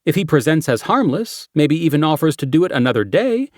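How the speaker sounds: brief the team like a cop; briefing detective voice. Acted. Character briefing quote.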